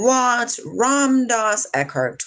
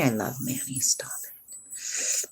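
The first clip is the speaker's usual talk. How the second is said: hushed fond whisper; whispered gushing; 8 semitones lower, 9 dB quieter, slower than usual. Real, not acted. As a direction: drop into an unvoiced whisper. Gushes about a TV character.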